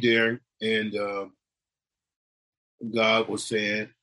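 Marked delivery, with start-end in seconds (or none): none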